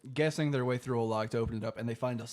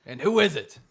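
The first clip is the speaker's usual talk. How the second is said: loud, raised mock-grand announcement; dramatic reveal; 4 semitones higher, 8 dB louder than usual. Storyteller reveals who is at the door, mock-dramatic. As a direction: build it up like a big entrance.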